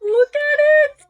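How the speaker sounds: very high, loud delighted squeal; excited squeal. Real, not acted. Vlogger showing off a queen crown, excited squeal.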